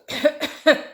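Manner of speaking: loud cough to clear the throat; a pompous throat clearing. A narrator's reading. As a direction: clear the throat before speaking. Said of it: "cleared his throat".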